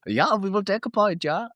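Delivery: a playful imitation of the German coach's accent; Daniel Farke impression. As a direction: do a light send-up of Farke's German English. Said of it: "your Daniel Farke impression".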